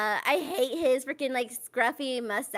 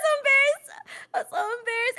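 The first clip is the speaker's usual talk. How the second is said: laughing; 9 semitones higher than usual. Real, not acted. Laughing, embarrassed.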